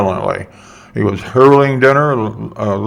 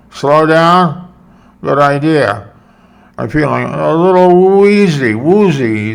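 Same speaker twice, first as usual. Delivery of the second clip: put-on slurred, sloppy drunk voice, higher; drunk slurring; 6 semitones higher, 4 dB louder, slower than usual. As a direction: slur the words like a drunk. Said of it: "slurring the words".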